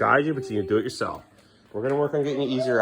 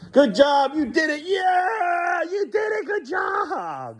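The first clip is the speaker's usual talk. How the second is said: excited cheering; much slower than usual. Real, not acted. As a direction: cheer him on like he won a trophy. Cheering child's potty success.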